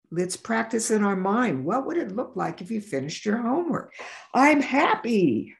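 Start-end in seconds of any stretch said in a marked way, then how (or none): 4.3-5.5 put-on happy child voice. Child voiced in a visualization example.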